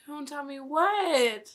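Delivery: usual speaking voice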